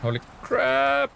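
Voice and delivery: high, drawn-out exclamation; startled outburst. Real, not acted. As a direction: stretch it out high in startled surprise. Startled outburst while playing game.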